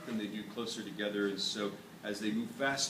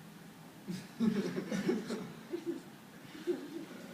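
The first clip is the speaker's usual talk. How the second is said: breathy unvoiced laugh after the punchline; amused laugh after a bad pun; teacher laughing at own joke; 11 semitones higher, 6 dB quieter than usual. Real, not acted.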